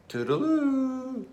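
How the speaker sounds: high, light mouse farewell; cheery goodbye. Acted. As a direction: sing out goodbye as you go.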